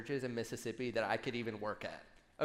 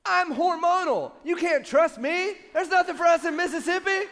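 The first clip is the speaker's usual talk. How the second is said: mock wife; 11 dB louder than usual. Imitating the wife insisting she can't be trusted. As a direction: play the wife, loud and over the top. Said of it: "She said".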